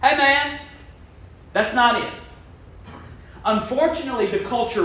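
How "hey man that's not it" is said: friendly correction. Acted. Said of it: "sometimes God has to say".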